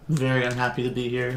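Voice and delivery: ordinary delivery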